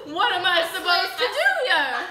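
a touch of mock despair; exasperated. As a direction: throw up your hands. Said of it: "I'm like".

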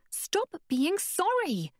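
high, slow exasperated cry; exasperated exclaim. Acted. Abeka telling Connor off, exasperated high cry. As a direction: burst out in frustration. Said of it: "she exclaimed".

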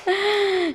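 drawn-in gasp of mock surprise; excited gasp. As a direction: gasp with delight for the children. Teacher acts excitement over lollipops.

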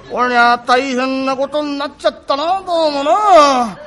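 slow, drawn-out lament; 困り果てたぼやき. Acted. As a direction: stretch out a troubled grumble to yourself. 難題を負って一人嘆く. (困り果てて間延びしたぼやき)

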